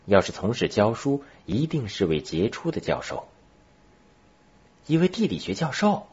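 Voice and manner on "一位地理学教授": high-pitched, amazed question; amazed child. Acted. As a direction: lift the voice high in boyish wonder. Boy learns he meets a professor. (男孩惊奇地高声问)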